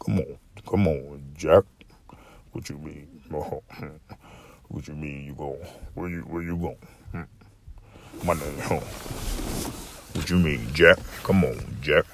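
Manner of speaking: very deep, hushed uncle voice; low, quiet throaty impression of an uncle; Uncle Sam. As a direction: sink the voice into the back of the throat. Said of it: "how Uncle Sam's voice was?".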